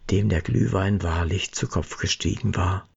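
usual speaking voice

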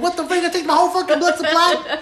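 high, loud, laughing disbelief; a high, loud, laughing mock outrage at the nurse. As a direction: shriek it half laughing. Retold outrage at blood draw, laughing.

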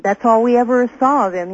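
ordinary talk

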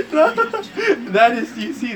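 high, very slow words through a laugh; laughing as the boss grabs the player. Real, not acted. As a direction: burst out laughing and drag the words. Boss grabs him mid-game.

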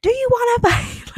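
high-pitched half-yell, retold; kinda yelling. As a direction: call it out to be heard over loud party music. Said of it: "kinda yelling a little bit".

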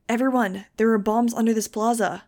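loud, urgent warning to a crowd; shouted warning. A narrator's reading. Narrator warning a crowd of bombs, urgent shout. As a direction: shout the alarm straight out. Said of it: "I shouted with no preamble".

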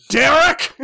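high, loud yell of a name; mock call-out. Acting out calling to Derek.

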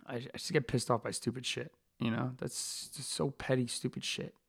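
hushed, breathy aside; muttered under breath. Real, not acted. Fighter grumbling about petty things after his rant, hushed. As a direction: say it almost to yourself.

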